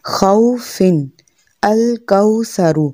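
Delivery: slow demo of खौफिन and अल कौसरु; rounded aw. Shown as a demonstration. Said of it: "लाजमन इसकी practice करें".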